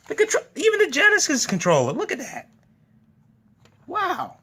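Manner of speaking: high-pitched cutesy squeal; squealing excitement. Real, not acted. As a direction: squeal over something tiny and cute. Gushing over tiny controllers.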